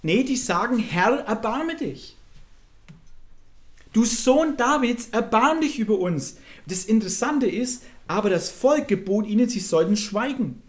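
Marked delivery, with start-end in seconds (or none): 0.8-6.4 acted plea, raised pitch, drawn-out words; flehendes Rufen. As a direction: call out for mercy, somewhat loudly. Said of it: "riefen sie und sprachen". (gespielt flehend rufen)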